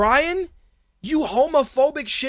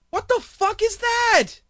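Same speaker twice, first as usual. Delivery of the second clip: incredulous; 10 semitones higher than usual. Real, not acted. Incredulous rant outburst.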